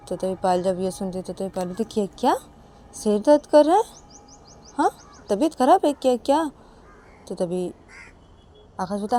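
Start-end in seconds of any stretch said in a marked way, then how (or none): 1.8-6.5 surprised friend. Acted. Payal surprised at headache news.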